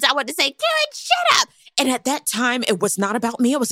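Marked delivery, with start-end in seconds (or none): 0.5-1.5 exasperated. Retold or imitated. What she wanted to say.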